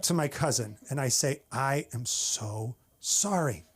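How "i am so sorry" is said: very slow, drawn-out "so sorry"; solemn condolence. Acted. To a cousin at a funeral, solemn condolence. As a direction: offer heavy, slow sympathy. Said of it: "So I'm at a funeral".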